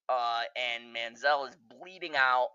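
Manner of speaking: normal talking voice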